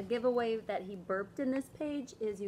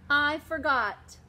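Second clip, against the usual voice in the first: louder, slow excuse; sheepish kid; 4 semitones higher, 8 dB louder, slower than usual. Acted. David explaining to mom, loud and slow. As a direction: blurt the excuse.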